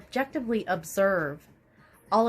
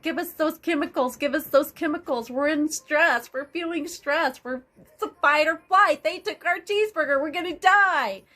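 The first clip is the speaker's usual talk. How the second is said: higher, pleading voice of a body craving stress chemicals; panicked body voice; 6 semitones higher, 4 dB louder than usual. Retold or imitated. The stressed body screaming for chemicals, hammed-up panic. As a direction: beg like a junkie needing a fix, near a shout at the end. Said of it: "It's like".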